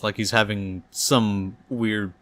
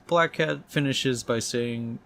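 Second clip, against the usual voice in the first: usual speaking voice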